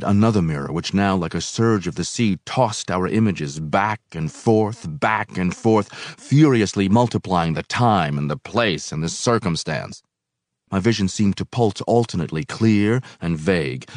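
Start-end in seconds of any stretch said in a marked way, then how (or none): none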